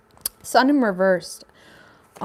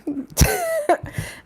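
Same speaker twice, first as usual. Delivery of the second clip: helpless laugh; real giggle fit; high squealing giggle. Real, not acted. Giggle fit during reading.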